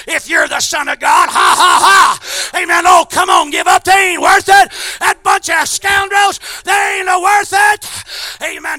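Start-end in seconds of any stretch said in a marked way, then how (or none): 0.1-2.6 loud, higher jeering devil laugh; mocking devil taunt. Preacher voicing the devil taunting Christ, loud mocking jeer. 2.9-7.9 loud, higher goading devil voice; tempting devil. Acted. Preacher voicing the devil urging Jesus to quit, loud goading.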